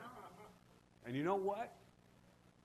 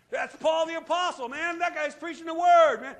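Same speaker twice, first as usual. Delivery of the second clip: louder and higher, building praise; fervent preaching shout; 12 semitones higher, 12 dB louder than usual. Real, not acted. Preacher praising Paul to the congregation, loud and fervent. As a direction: shout it out for the apostle.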